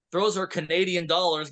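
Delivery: ordinary talk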